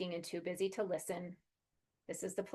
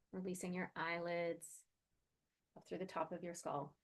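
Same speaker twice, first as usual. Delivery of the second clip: calm grounding exercise; 4 dB quieter than usual. Acted. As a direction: gently guide them into their body. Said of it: "let's check in and do a quick grounding exercise".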